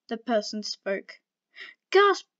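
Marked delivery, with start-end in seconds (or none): 1.9-2.3 high, loud, dramatic spoken "Gasp!". Acted. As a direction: act out "Gasp!" as a sharp surprised cry. Reading out a gasp line.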